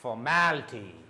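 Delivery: Formality with MAL up, then down on ty; stress on MAL. Shown as a demonstration. Teacher applying the up-then-down rule, stress on MAL. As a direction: raise MAL in Formality, let the end fall. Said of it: "mal is up".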